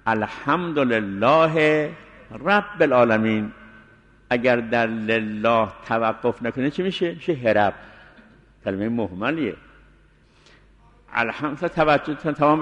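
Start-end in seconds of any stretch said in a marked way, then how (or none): none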